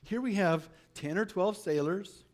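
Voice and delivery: normal talking voice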